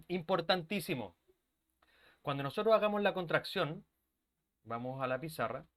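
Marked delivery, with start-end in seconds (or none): none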